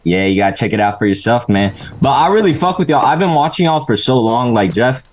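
ordinary talk, nothing marked

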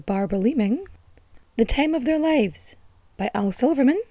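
loud, proclamatory reading of titles; grand source reading. Acted. Host reading her sources aloud, loud proclamation. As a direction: announce each book like a herald.

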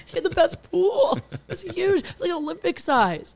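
high-pitched, laughing enthusiasm; giddy raving about a big perk; gushing excitement. Real, not acted. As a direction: gush like you are truly impressed. Gushing about the date's pool.

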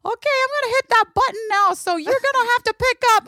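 put-on high, chatty little kid voice; little boy voice. Nephew tells his aunt what he's up to, in a high kid voice. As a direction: play a five-year-old wandering the house. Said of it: "And he's like".

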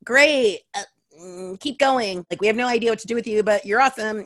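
high, loud, gushing and bouncy; mock gushing. Producers praising a solo performer, high gushing mock enthusiasm. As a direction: play the baffled but enthusiastic producers. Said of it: "everyone's like".

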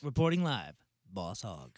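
mock reporter sign-off. Mock reporter sign-off as Boss Hog.

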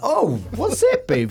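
high, slow, puzzled call to her partner; high, drawn-out, wondering what the noise was; puzzled question. Retold or imitated. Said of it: "And I'm like".

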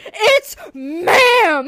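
loud, high, crying shout of "It's ma'am"; shouted meme quote. Retold or imitated. Quoting the viral clip with a tearful shout. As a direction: yell the line like the viral clip.

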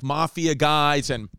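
ordinary talk, nothing marked

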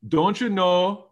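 put-on Minnesota accent. Host shows off his favorite Minnesota phrase, Minnesota accent. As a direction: stretch it like a Minnesota local. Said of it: "the two I really like is".

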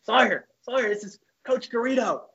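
high-pitched impression of an old coach; Coach Garrido. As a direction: put on the coach's high phone voice. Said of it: "He's like".

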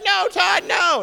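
very high shouted no; wife screaming in alarm; horrified wife. Retold or imitated. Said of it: "And she's like".

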